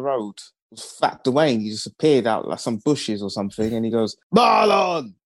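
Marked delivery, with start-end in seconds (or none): 4.4-5.1 loud call of a deep-voiced kid; deep voiced child. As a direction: shout his name like a big bully kid. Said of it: "That's how his voice was".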